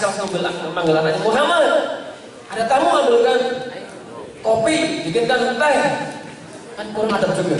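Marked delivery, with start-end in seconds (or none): 1.2-5.8 high, mock calling across the house; calling out loud. Shown as a demonstration. Father calling son to bring coffee for guest, mock loud call. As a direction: hail the son from another room. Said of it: "Ada teriak, misalnya keras".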